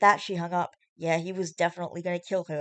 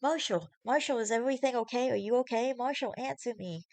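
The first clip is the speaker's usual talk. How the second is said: higher, anxious pleading; worried voice; 7 semitones higher than usual. Acted. Fiona worried on phone.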